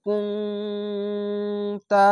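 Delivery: কুনতা with a long nasal hum on the ন, very slowly; nasal ghunna. Shown as a demonstration. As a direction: hum the ন through the nose. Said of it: "এখানে ইকফা গুন্না". (গুন্না দিয়ে ধীরে পড়া)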